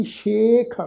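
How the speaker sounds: ਸ਼ੇਖ starting with "sh", then long e; dotted sh sound. Shown as a demonstration. Teacher showing the dotted sassa, ਸ਼ੇਖ with a "sh" start. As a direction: open ਸ਼ੇਖ on a clear "sh". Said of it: "ਪੈਰ 'ਚ ਬਿੰਦੀ ਦੀ ਮਾਤਰਾ".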